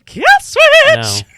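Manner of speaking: high, loud fighting game announcer shout; toasty guy voice. Acted. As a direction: scream it like the Mortal Kombat announcer. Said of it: "Do it more like the toasty guy".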